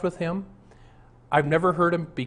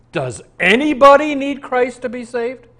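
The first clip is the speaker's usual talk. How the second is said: louder, higher, pressing the question home; emphatic challenge; 9 semitones higher, 9 dB louder than usual. Real, not acted. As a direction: throw the question at them like a challenge. Preacher challenging liberal pastors directly.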